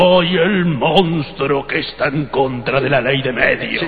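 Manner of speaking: slow, drawn-out monster voice. Shown as a demonstration. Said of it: "hace voz de monstruo".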